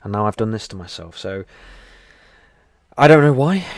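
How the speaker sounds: ordinary delivery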